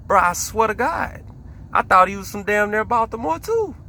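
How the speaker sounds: can't believe he's British, voice climbing; incredulous. Real, not acted. Disbelief about Idris Elba.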